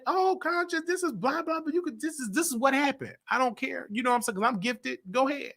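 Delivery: a touch high, casual dismissive; breezy brush-off. Retold or imitated. To Conscious asking for the rundown, mildly high careless brush-off. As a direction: toss it off lightly, not caring.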